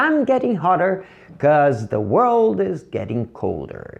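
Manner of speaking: slow, careful English sentence read; slow demo sentence for students; model English sentence. Shown as a demonstration. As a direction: read it slowly as a model for the class. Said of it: "Vou falar".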